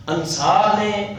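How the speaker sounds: usual speaking voice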